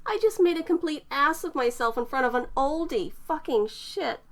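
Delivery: cursing herself, flustered; lightly voiced young woman, mortified. A narrator's reading. Eterna mortified rant.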